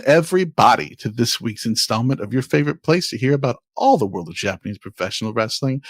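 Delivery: ordinary talk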